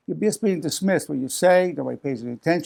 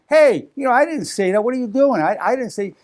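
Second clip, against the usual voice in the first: higher and louder, an indignant "I didn't say that"; defensive retort; 7 semitones higher, 4 dB louder than usual. Retold or imitated. Answering someone who twisted your idea, defensive and loud. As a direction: snap back defensively at a misunderstanding. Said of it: "it may come across in a defensive way".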